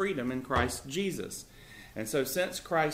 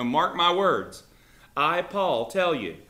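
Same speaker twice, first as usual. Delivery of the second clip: slow, louder warning read from the text; emphatic reading; 4 semitones higher, 8 dB louder, slower than usual. Shown as a demonstration. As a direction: stress each word so they pay close attention. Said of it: "a phrase that's added for emphasis".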